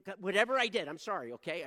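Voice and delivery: loud burst, then easing off; dismissive blanket apology. Shown as a demonstration. Man apologizing to God for whatever, mock dismissive plea. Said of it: "don't apologize to God".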